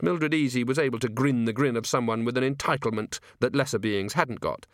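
ordinary delivery

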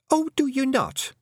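sharp. A narrator's reading. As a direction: snap back scornfully. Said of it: "said Fionn sharply".

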